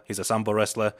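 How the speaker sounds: ordinary talk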